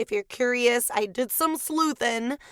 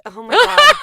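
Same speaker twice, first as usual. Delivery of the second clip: squeaky voice; 8 semitones higher, 13 dB louder than usual. Real, not acted. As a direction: voice cracking high and loud. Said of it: "How you doing there, Squeaky?".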